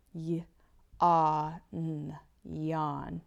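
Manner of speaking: "y-, ah, n" split out, then "yawn"; sounded out yawn. Shown as a demonstration. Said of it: "I can hear it as I say it".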